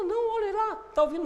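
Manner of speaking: high-pitched urging to listen; acted-out friend pointing something out, pitched high; insistent friend. Friend urging a listener to hear a little bird, insistent.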